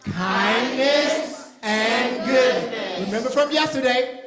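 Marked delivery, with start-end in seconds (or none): 0.0-3.0 very slow, drawn out word by word; slow call-and-response. Shown as a demonstration. Leader slowly leading the children to repeat the theme. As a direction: stretch each word so the kids can follow. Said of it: "Say it with me".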